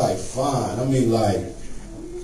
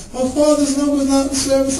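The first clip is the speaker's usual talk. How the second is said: put-on higher, puzzled child voice; baffled kid; 10 semitones higher than usual. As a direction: repeat it like a confused little boy.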